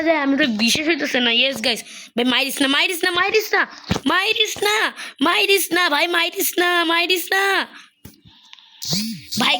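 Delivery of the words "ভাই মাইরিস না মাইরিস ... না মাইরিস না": high-pitched begging; whiny high begging; desperate pleading. Real, not acted. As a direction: plead faster and faster not to be hit. Begging not to be killed.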